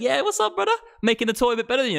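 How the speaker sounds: high-pitched, taunting mock boast; cocky rival friend. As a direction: crow it high like a show-off mate. Acting out a competing friend's boast.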